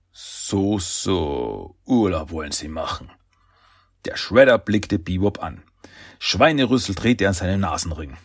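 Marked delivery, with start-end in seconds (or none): 0.1-3.1 low, slow, drawn-out villain voice; quiet villainous menace, pitched deep; menacing villain. A narrator's reading. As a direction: drop low and stretch it out ominously. Villain Shredder sneers at holiday wish.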